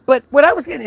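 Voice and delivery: high, loud mock protest; mimicked protest. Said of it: "she goes".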